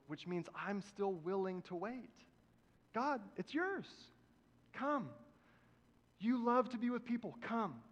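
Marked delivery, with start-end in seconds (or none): 2.9-7.8 tender prayer. Pretend. Pastor praying, inviting God.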